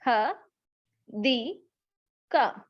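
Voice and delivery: "ह, दी, का" as three isolated sounds; long gaps between "ह", "दी" and "का"; separate syllable sounds. Shown as a demonstration. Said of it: "Focus on sounds". (हर आवाज़ अलग, रुक-रुक कर)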